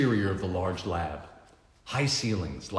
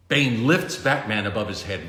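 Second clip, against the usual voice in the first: usual speaking voice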